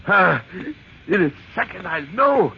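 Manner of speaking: loud, high, breathless anticipation; on the edge of discovery; feverish excitement. Acted. Breaking into the statue.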